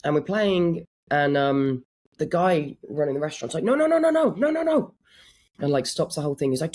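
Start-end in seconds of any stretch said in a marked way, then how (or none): 3.6-4.9 high-pitched mock protest, rattled off in a run; imitated restaurant manager. Restaurant manager stopping a guitarist mid-gig, high mock protest. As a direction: cut in like a fussy manager, voice jumping right up. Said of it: "that's my accent".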